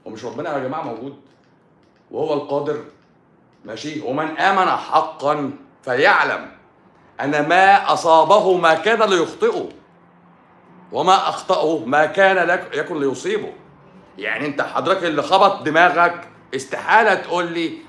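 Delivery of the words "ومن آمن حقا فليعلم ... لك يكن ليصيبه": recited hadith. Shown as a demonstration. Preacher reciting the hadith to the viewer, solemn intoning. Recites religious saying slowly.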